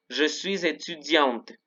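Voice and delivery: "suis" linked into "étudiante", slow; liaison. Shown as a demonstration. Teacher models liaison for students, slow French. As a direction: link the S of "suis" to "étudiante", T sounded. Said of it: "Recuerden la liaison aquí".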